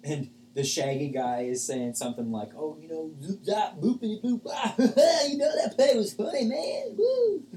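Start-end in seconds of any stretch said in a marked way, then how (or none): 2.5-7.5 high, loud, spaced-out stoner voice with a laugh; stoned party guest. Acted. GM voicing the shaggy guest to the party, high loud stoner voice. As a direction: play the giddy stoner gushing about the show.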